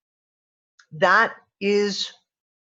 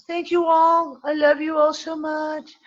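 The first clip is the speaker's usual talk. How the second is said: high, drawn-out, gushing farewell; sing-song it fondly, stretching the words; affectionate singsong; 9 semitones higher, slower than usual. Real, not acted. Host thanking everyone at sign-off, high affectionate singsong.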